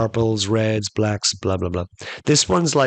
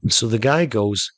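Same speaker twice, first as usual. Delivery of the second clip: ordinary delivery, nothing marked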